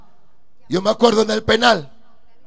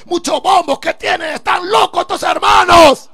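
an annoyed inmate ranting about the noise, loud and higher; angry complaint; 6 semitones higher, 5 dB louder than usual. Retold or imitated. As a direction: rage about the drums. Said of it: "Así yo hablaba".

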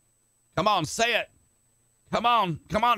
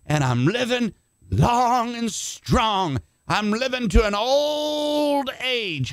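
higher, slow and stretched out on the last lines; preached declaration; 5 semitones higher than usual. Retold or imitated. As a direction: proclaim it like a vow to the giant. Retold defiant declaration to giant.

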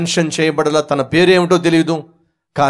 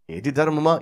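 calm husband; 3 semitones lower, 8 dB quieter than usual. Acted. Husband replying quietly.

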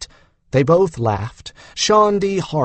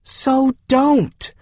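sharp, high exasperated burst; 7 semitones higher than usual. A narrator's reading. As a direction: cut her off, fed up with her. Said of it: "Exasperation crept into Shandi's voice".

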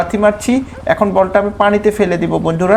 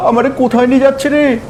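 normal talking voice

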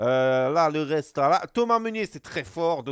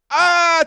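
sudden loud, high "ah" of joy; delighted shout; 11 semitones higher, 12 dB louder than usual. Real, not acted. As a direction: burst out with a high happy yell. Gets a player he wanted.

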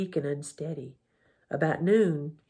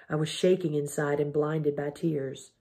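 usual speaking voice

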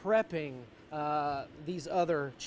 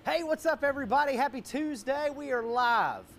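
excited; 7 semitones higher, 4 dB louder than usual. Real, not acted. Host opens the livestream.

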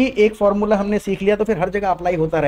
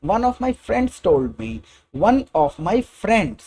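very slow, stressed "of" and "one"; slow teaching emphasis; slow emphatic phrase; 3 semitones higher, much slower than usual. Shown as a demonstration. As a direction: draw out "one of my friends". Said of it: "एक दोस्त पे emphasise करने के लिए कई तरीके से".

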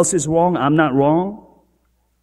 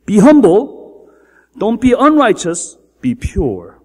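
emphatic command; 4 semitones higher, 6 dB louder, slower than usual. Acted. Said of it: "we turn it opposite and say".

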